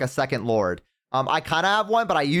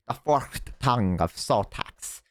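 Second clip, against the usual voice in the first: slow, lower, grand epic-narrator line; dramatic intonation; 4 semitones lower, 3 dB quieter, slower than usual. Pretend. Gamer naming a Lizardmen relic in a slow, grand voice. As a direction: intone it like a fantasy trailer.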